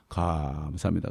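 slow, low, soft thank-you; humble thanks. Retold or imitated. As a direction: draw out the first syllable meekly. Same meek thanks despite abuse.

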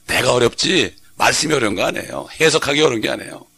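normal talking voice

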